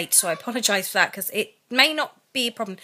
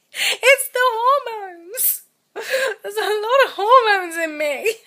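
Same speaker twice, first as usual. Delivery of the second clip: high, slow, tearful and breaking; crying, half laughing, voice wobbling; real crying; 11 semitones higher, slower than usual. Real, not acted. Exhausted pregnant mum explaining her tears, crying.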